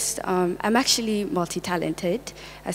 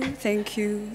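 ordinary talk

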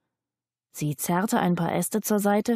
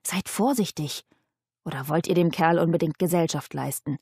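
ordinary delivery, nothing marked

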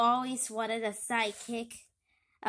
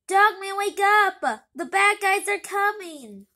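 loud, higher warning call; urgent shout; 6 semitones higher, 10 dB louder than usual. Acted. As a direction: wake him up, shout.

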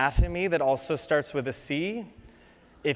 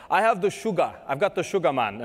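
badly imitated Jamaican accent, slightly raised and pushed; terrible Jamaican accent; 3 semitones higher than usual. Dentist imitating Jamaican patients saying they have diabetes, bad Jamaican accent. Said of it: "I'm gonna do terrible Jamaican accents".

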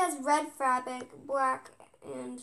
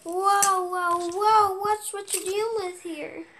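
loud, higher swaggering whoa; loud mock boast; 4 semitones higher, 10 dB louder, slower than usual. Real, not acted. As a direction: show off your power, loudly. Boasting after being sprayed.

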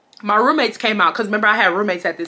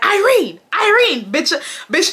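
mock high yelling; 8 semitones higher than usual. Mocking his calls, retold.

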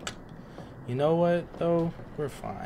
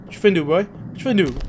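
loud, higher taunt; loud cocky taunt at the demon; taunting call-out; 8 semitones higher, 8 dB louder, faster than usual. Real, not acted. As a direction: challenge him, loud and cocky.

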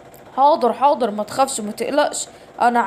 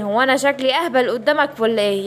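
ordinary talk, nothing marked

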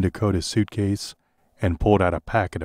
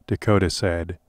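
ordinary delivery

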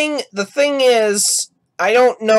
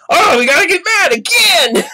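high-pitched mock outburst; mock angry shout; 10 semitones higher than usual. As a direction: shout it in hammed-up exasperation. Mocks a dreaded video premise.

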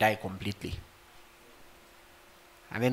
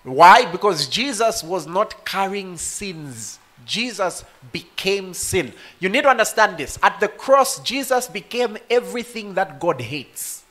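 loud, high, impassioned preaching; full-force preaching; 7 semitones higher, 6 dB louder than usual. Acted. Preacher crying out the cross story to the congregation, fervent.

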